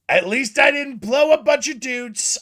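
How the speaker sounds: high, loud mock jab, pitched well up; Trump. Streamer inventing a better Trump diss, loud mocking voice. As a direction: ham up the insult he should have used. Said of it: "why didn't Trump say".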